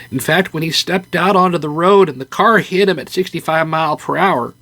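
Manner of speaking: a hint of a chirpy older mother; Carl's mom. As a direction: play the cheery mom breaking the news. Voicing Carl's mom in story.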